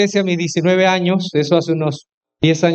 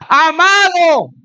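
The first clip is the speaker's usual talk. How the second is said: high, loud, long-drawn "Amado!"; shouted greeting across the street; 9 semitones higher, 5 dB louder, much slower than usual. Retold or imitated. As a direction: call out joyfully from a block away. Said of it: "y me grita".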